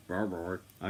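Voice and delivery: a hint of a deep-voiced dog. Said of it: "Gave him a deep voice for a nine-month-old dog".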